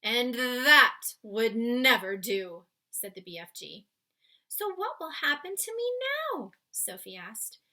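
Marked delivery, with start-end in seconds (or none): 0.0-2.7 slow, louder giant voice; firm giant voice. Acted. Giant deciding Sophie's fate, slowly. BFG voiced in read-aloud. 4.5-6.5 put-on higher, small girl's voice; worried little girl. Sophie asking the giant about her future.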